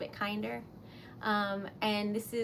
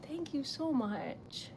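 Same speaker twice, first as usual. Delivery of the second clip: choked up; 4 semitones higher, 9 dB quieter, slower than usual. Real, not acted. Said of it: "Now I'm gonna cry".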